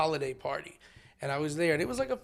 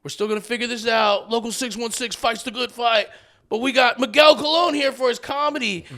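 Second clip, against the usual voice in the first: high, loud host calming a crowd; loud emcee; 7 semitones higher, 12 dB louder than usual. Retold or imitated. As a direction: boom it out like a stage host.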